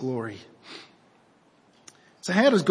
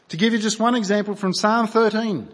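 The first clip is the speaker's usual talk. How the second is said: ordinary talk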